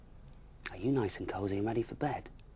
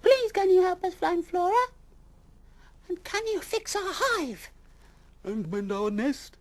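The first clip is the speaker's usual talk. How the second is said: pleading animals; 11 dB louder than usual. Acted. Animals begging Mister Bear, very high pleading. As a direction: beg him for help.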